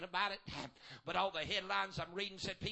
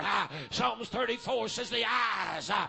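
rhythmic whooping preacher cadence; full-force preaching; 6 dB louder than usual. Acted. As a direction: build it back up, chanting each phrase. Preaching builds again.

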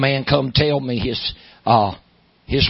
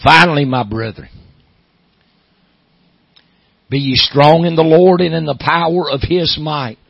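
scripture reading; 3 semitones higher, 6 dB louder than usual. Acted. Reads scripture in sermon.

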